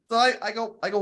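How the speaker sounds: usual speaking voice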